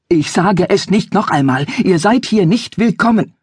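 a father turning away unwelcome visitors at night; father orders strangers out, rising anger; angry father. Acted. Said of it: "Ihr Vater war zornig".

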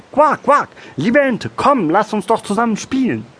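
very high-pitched quacking frog voice; eager little frog. A narrator's reading. Frog invites the duck. (hohe, lockende Froschstimme)

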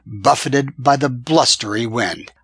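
plain reading voice

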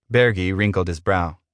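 ordinary delivery, nothing marked

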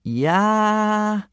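half-hearted, held-out cry; unenthusiastic war cry. A narrator's reading. Two reluctant boys repeating the war cry, half-hearted. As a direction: go through the motions of a battle yell. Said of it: "without much enthusiasm".

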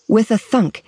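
ordinary delivery

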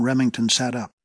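ordinary delivery

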